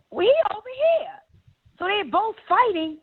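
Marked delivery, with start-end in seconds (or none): 0.1-1.1 lightly voiced high sing-song call; a hint of a woman calling out; mock high call. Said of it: "China said".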